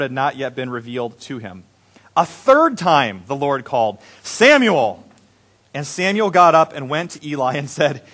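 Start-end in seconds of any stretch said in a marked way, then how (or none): 4.2-5.0 high, raised call of a name. A narrator's reading. The Lord calls a third time, calling out.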